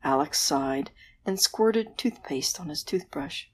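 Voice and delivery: ordinary delivery, nothing marked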